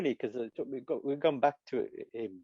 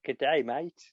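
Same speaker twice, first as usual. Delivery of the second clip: brief put-on Australian accent; slower than usual. Demonstrating voices from around the world, in an Australian accent. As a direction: greet like a mock Aussie.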